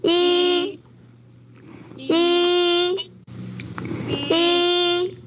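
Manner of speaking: 一 held high and level; first tone yi. Shown as a demonstration. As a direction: sing 一 on a long flat high pitch. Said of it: "the E is the first tone".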